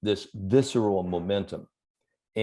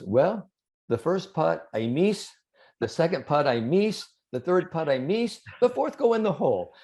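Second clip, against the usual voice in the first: higher-pitched imitation of the great Spaniard, deadpan; Seve impression; 8 semitones higher than usual. Seve telling the press room about his four putts, higher-pitched imitation. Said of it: "in that great, you know, Seveism".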